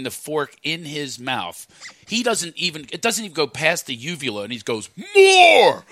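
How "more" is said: put-on high, loud toddler holler; greedy toddler. Toddler demanding food from his uncle, high drawn-out shout. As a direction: bellow it like an insatiable two-year-old. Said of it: "he's, like, two years old".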